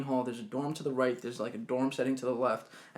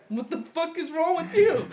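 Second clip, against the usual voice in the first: high-pitched, baffled protest; incredulous; 4 dB louder than usual. Retold or imitated. Guy buttoning his boxers to screaming girl, high incredulous protest. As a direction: squeal it in mock disbelief. Retold bewildered reaction to screaming.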